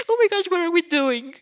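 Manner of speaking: high, loud laughing exclaim. Real, not acted. Reader cracking up at her own story, laughing. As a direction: crack up at the scene.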